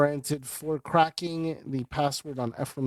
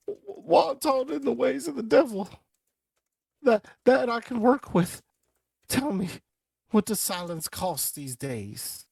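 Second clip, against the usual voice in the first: high, slow scheming voice; sly villain; 9 semitones higher, slower than usual. Acted. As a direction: turn from crying to cunning bargaining. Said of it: "He's like".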